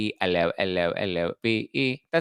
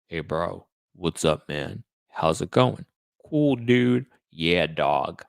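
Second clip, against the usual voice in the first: put-on low-pitched bro voice; code-switch into mock straight dude; straight-guy impression; 3 semitones lower, 4 dB louder, slower than usual. Shown as a demonstration. Showing chat viewers his code switching, straight-bro impression. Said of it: "likes my straight impression".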